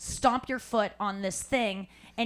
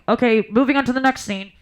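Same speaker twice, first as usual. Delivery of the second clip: louder, announcement over a mic; director on the mic; 11 dB louder than usual. Retold or imitated. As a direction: call it out like a stage PA. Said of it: "the voice of God".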